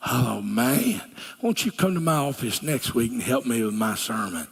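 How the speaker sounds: ordinary talk, nothing marked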